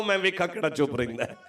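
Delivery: ordinary talk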